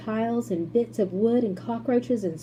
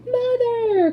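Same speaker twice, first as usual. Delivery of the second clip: high, loud joyful call; calling out to his mother; joyful cry; 7 dB louder than usual. A narrator's reading. Charlie crying to his mother as he rushes in. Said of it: "cried Charlie".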